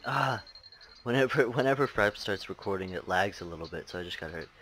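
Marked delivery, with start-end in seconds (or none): none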